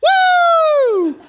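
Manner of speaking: very high, loud "woo" shriek; piercing scream. Retold or imitated. Lady looking right at the preacher, piercing scream. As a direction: let out one long piercing woo. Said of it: "and she goes".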